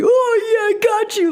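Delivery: imitating a ridiculous Packers superfan bit; over-the-top fan guy. Impression of a comedian's Green Bay Packers fan character, hammed-up. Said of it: "like, Green Bay Packer, like, way fan guy".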